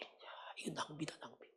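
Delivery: hushed, unvoiced grumbling; whispered grumble. Preacher voicing onlookers muttering about the wasted perfume, in a whisper. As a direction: whisper it like a sneering bystander.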